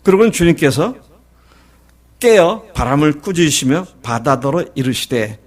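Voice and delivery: ordinary delivery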